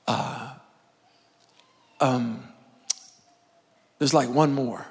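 low, soft, hesitant admission; reluctant father. Acted. Jesse admitting to Samuel he has another son, reluctant. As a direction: stall with long pauses, mumbling it out reluctantly. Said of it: "Jesse goes".

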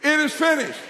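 loud, high rallying cry; out-loud declaration. Shown as a demonstration. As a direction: get the crowd saying it with you. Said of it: "say it with me".

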